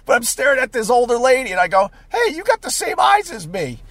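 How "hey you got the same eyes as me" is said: high-pitched mock-friendly line; goofy high voice, sliding down at the end; silly chirpy quote. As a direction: re-enact it pitched well up. Repeats his silly line.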